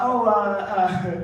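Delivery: ordinary talk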